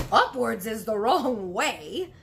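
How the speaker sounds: slow, loud scolding insistence; indignant cry. Acted. BFG correcting Sophie, slow loud indignant cry. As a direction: set her straight, outraged. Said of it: "cried the B F G".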